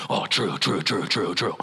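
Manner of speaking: nod along grudgingly; mumbling agreement. Retold or imitated. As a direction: Pharisee agreeing in a whispered huddle. Pharisees agreeing in huddle.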